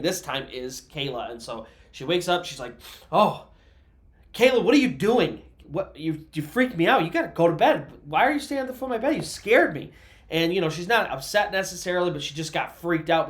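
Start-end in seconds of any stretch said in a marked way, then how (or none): 3.1-9.9 startled mom. Retold or imitated. Mom startled awake by daughter.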